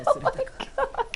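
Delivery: very high squeal of laughter; helpless laughter. Real, not acted. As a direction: squeak the words out slowly through laughing. Fails again, helpless laughter.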